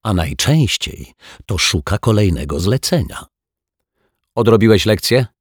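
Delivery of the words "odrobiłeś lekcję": higher, louder needling question; spiteful little dig; malicious jibe. A narrator's reading. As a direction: needle the boy with a raised voice. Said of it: "nie mógł powstrzymać się od złośliwości".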